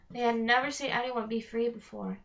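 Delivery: ordinary delivery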